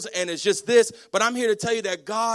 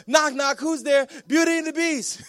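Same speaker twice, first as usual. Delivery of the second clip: put-on young son; 7 semitones higher than usual. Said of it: "he goes".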